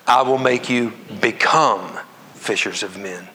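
"become" slow and set off by pauses; stress on become. Shown as a demonstration. Preacher rereading the verse aloud with congregation, stress on become. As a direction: stretch the word "become", then pause before the rest. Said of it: "reread it and emphasize the different".

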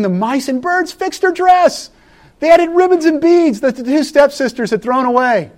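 high storybook voice. Acted. Story narration of cheerful mice.

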